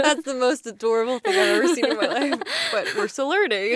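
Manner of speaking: fast laugh-talk. Real, not acted. Host laughing as she sums up the story. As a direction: giggle through the line.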